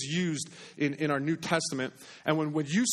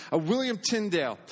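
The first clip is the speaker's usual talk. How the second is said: ordinary talk, nothing marked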